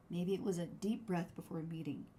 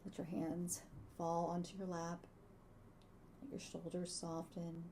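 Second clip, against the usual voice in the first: soft spa whisper; 7 dB quieter than usual. Acted. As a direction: ease them into calm, softly. Leads a gratitude meditation.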